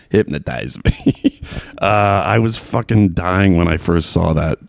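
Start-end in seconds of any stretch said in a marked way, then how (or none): 0.1-1.4 low, slow mock Chicago accent; Kevin Bacon's Chicago accent. Podcaster baffled by the actor's accent, mimicking his Chicago twang. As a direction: drag out the movie line in Chicago vowels. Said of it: "Maybe that's how people in Chicago talk".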